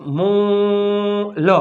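મોલ with a very long vowel, then L; sounded out. Shown as a demonstration. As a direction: hold the 'o' in મોલ slowly. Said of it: "મો એટલે એમ". (મોલ ખૂબ લંબાવીને)

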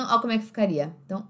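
ordinary talk, nothing marked

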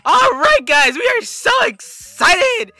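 high, loud, slow excited shout; over-hype every word at a yell; hammed-up excitement; screaming YouTuber impression. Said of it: "That really hurt my voice".

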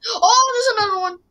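loud high startled cry; startled shout. Real, not acted. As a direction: jump at the sudden guard. Second guard pops up.

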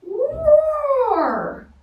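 very high, loud long roar; big bear roar. A narrator's reading. Bear at the girl's front door, huge long roar. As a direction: let out one huge roar.

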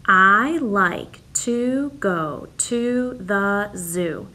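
very slow, each word set apart; slow counting read. Shown as a demonstration. Teacher reads slowly so the class counts each word. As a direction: drag it out so kids can count words. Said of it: "count the words in your sentence".

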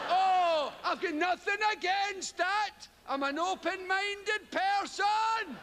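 high, slow, loud protesting too much; hammed-up slow indignant protest; mock defensive protest. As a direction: insist you're open-minded, overdoing it.

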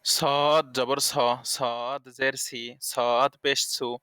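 স drawn out slowly, heavy with a hiss; slow, drag স thick with a hiss; slow thick whistled sad. Shown as a demonstration. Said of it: "শীষসহ মোটাভাবে".

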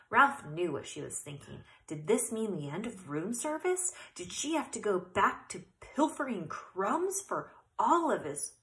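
ordinary delivery, nothing marked

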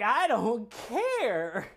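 slow, stretched-out I don't care; drawn-out indifference. Retold or imitated. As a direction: drag it out, bored and unbothered. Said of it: "I'm like".

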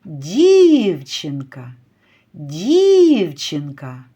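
дівчинка with a very long first І; calling out. Shown as a demonstration. Teacher calls the girl to find the stress, drawing out дівчинка's first І. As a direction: slowly call дівчинка, stretching the first syllable. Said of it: "покличемо дівчинку".

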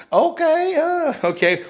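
very high, lilting acknowledgement; accepting salesperson. Acted. Salesperson hears answer about past purchases, accepting and high. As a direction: take in the answer with high-pitched okays.

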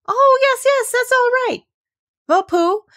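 very high, loud and bright-eyed; excited high-pitched yes; eager agreement. A narrator's reading. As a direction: pipe up loudly in a high, eager voice. Rabbit eagerly agrees, hurries on.

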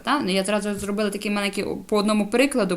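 usual speaking voice

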